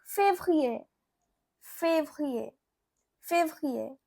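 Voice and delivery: février as "fayv-ryay", French r; French pronunciation. Shown as a demonstration. Teacher showing learners February in French, careful French février. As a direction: repeat février evenly, pausing between. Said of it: "You can say".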